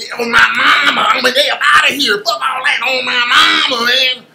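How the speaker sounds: mock hyped-up coonish voice. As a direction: ham it up like a clout chaser. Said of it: "Being all that old".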